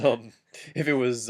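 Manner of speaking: ordinary delivery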